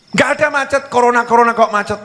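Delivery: high-pitched, disbelieving challenge; acted nagging wife; challenging wife. Acted wife doubts husband's traffic excuse.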